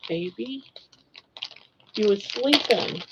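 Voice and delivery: normal talking voice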